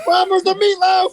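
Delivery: high, loud, slow whiny call to mom; mock kid calling mom. Kid hollering to mom about the missing meatloaf, high and loud. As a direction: whine for dinner like a hungry kid.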